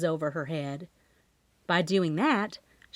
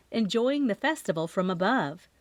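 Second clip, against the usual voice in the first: ordinary talk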